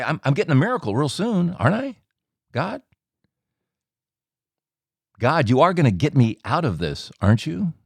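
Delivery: high, wheedling appeal with rising tag questions; pleading expectantly. Retold or imitated. Man acting out his old prayer for a miracle, pleading expectantly.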